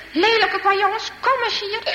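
high-pitched storybook scolding call; angry calling out. Acted. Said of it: "En hij roept weer". (boos roepend, hoog van toon)

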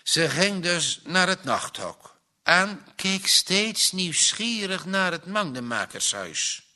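ordinary delivery

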